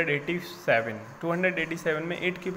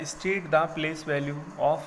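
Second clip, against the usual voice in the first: slow reading; much slower than usual. Shown as a demonstration. Teacher reads the question.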